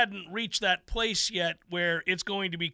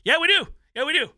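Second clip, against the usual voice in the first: emphatic retort; 6 semitones higher, 6 dB louder, much faster than usual. Retold or imitated.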